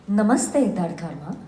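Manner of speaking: a composed game character saying hello; measured, gracious character voice; in-character greeting. Shown as a demonstration. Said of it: "in your voice could say hi".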